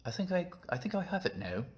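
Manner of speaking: high put-on British accent; playful imitation of British speech. Shown as a demonstration. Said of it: "That's a British accent".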